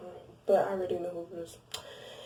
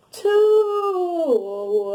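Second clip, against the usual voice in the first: "Two" punched high and loud; stress on Two; 10 semitones higher, 9 dB louder than usual. Real, not acted. Stressing to viewers he had two kids, loud emphasis. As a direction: hit "Two" hard and high.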